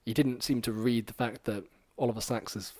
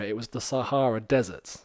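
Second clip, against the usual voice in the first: ordinary talk, nothing marked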